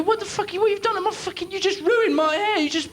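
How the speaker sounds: shrill high squawk of an outraged customer. Retold or imitated. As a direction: squeal it way up high like a furious punter. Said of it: "And they go".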